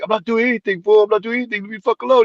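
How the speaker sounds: high-pitched, insistent denial; imitated defensive protest. Tim arguing back at the cop, imitated defensive protest. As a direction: mimic a teenager protesting his innocence.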